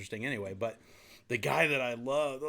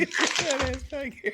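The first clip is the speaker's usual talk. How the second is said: put-on high childish yell through laughter; kid voice repeated; 6 dB louder than usual. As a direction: echo the kid's line while laughing. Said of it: "heard myself as a child".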